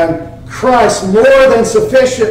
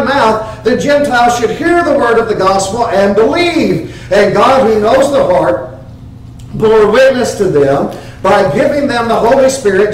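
higher, raised scripture reading; preaching scripture; 4 semitones higher than usual. Acted. Preacher reading Peter to the congregation, proclaimed. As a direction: proclaim Peter's words to the church.

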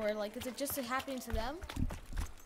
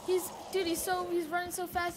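usual speaking voice

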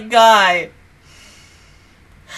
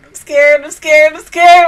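louder, climbing higher with each repeat; scared, panicky repeating; 4 semitones higher, 6 dB louder than usual. Real, not acted. Viewer dreading next week's episode, mounting panic. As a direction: work up into a rising panic.

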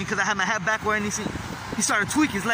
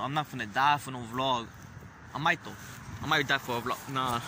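ordinary talk, nothing marked

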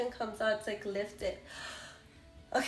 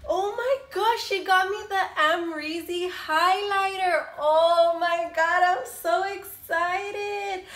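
higher and louder, gushing with delight; excited squeal; 6 semitones higher, 7 dB louder, slower than usual. Real, not acted. Vlogger seeing the highlighter her friend sent, delighted squeal. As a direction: squeal it out, stretching the last words.

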